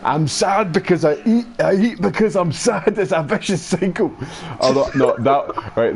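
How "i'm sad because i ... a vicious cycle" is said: high, self-pitying Scottish villain; Fat Bastard. Acted. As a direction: wail it in mock Scottish. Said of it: "same voice he used for Fat Bastard".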